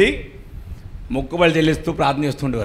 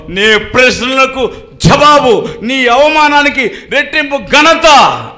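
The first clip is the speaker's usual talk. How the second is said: raised, high, forceful preaching; full-force sermon voice; fervent preaching; 9 semitones higher, 8 dB louder, slower than usual. Acted. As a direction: preach it out to the congregation. Preacher proclaims promise.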